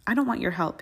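usual speaking voice

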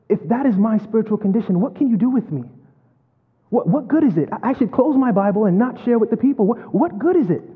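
a broken man begging the Lord why; anguished plea. Retold or imitated. Preacher cries out to Lord.

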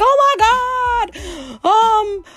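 very high, drawn-out mock panic; mock panicked person. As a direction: ham up a frantic news reaction. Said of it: "You're like".

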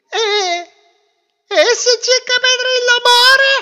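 high, loud tearful wail; crying like babies. Acted. Wife explaining their grief to husband, hammed-up wailing. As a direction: sob out the story, climbing to a shriek. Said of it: "piangevano come due creature".

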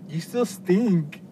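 mom voice. Retold or imitated. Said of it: "she's like".

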